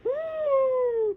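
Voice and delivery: high tearful gasp; crying gasp. Real, not acted. Reader overwhelmed by the scene, crying gasp. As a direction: gasp through tears. Reacting to twist.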